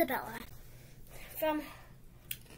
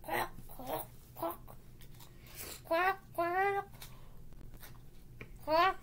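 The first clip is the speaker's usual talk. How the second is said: Donald Duck voice; 4 semitones higher, 4 dB quieter, much slower than usual. Retold or imitated. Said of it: "That was my Donald Duck".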